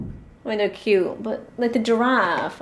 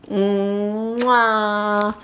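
long hummed "mwah" kiss; exaggerated kiss. Woman signing off the vlog, exaggerated goodnight kiss. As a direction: hum into a big smacking kiss.

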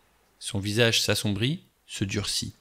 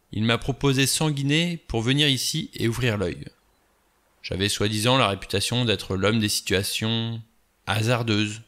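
ordinary delivery, nothing marked

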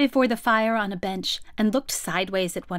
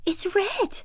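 higher, puzzled exclamation; lightly voiced curious little girl; 7 semitones higher than usual. A narrator's reading. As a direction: pipe up high, then puzzle over it.

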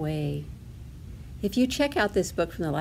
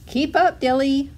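high, louder motherly call; 6 semitones higher, 5 dB louder than usual. A narrator's reading. Mama Duck to lagging duckling, calling out. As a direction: call ahead to a straggler, pitched high. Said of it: "Mama Duck called out".

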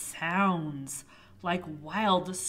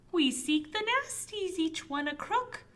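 high knight voice; 9 semitones higher than usual. Acted. Knight answers witch.

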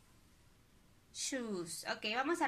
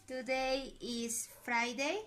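slow dictation; much slower than usual. Shown as a demonstration. Teacher dictating the date.

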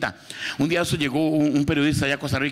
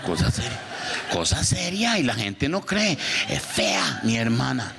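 ordinary delivery

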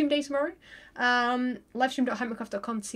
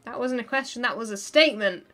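ordinary talk

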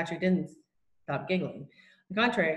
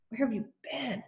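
urgent hushed question, pitched up; under his breath; 5 semitones higher, 6 dB quieter than usual. A narrator's reading. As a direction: softly demand it under your breath. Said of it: "asked Omri under his breath".

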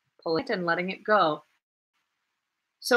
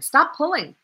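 urgent; 9 semitones higher, 7 dB louder than usual. Acted. Urgent warning.

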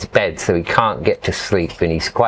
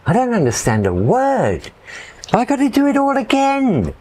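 dismayed; 13 semitones higher than usual. Retold or imitated. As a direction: realise you must start over. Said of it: "I'm like".

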